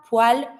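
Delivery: poêle said pwal, with ah; A sound. Shown as a demonstration. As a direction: open poêle to an ah vowel. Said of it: "with more like a A sound".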